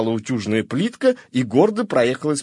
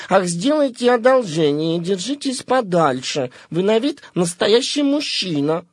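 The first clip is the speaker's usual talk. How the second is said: put-on higher, flustered young lady; 7 semitones higher than usual. A narrator's reading. The garter fends off the collar's advances, higher and flustered. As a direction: flutter and wave him away.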